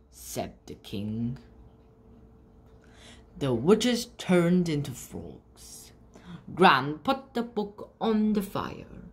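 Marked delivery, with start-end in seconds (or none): none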